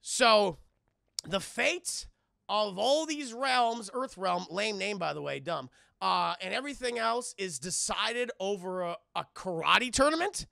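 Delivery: loud scoffing disbelief; incredulous rant. Retold or imitated. Johnny mocking the tournament to Liu Kang, loud scoffing. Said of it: "Johnny Cage is like".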